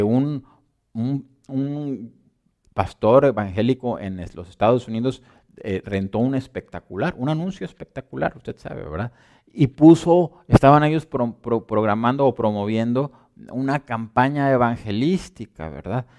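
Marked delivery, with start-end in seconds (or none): none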